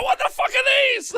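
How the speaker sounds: high, loud baffled outburst; bewildered yell at the chaos; confused Charlie. Pretend. Charlie yelling at the battle, high baffled outburst. Charlie voiced, Stormcast landing.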